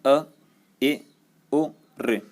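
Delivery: अ and ए clipped short and quick; short vowels. Shown as a demonstration. Teacher shows how short vowels are said. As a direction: snap each short vowel out fast. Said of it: "बहुत कम समय में अ झटके से".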